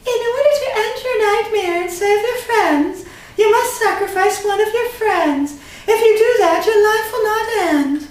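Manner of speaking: very high, prissy villain voice; a fussy, snooty villain laying down the terms. Acted. Unknown ranger giving Jason a cruel choice, high prissy voice. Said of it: "the prissy voice".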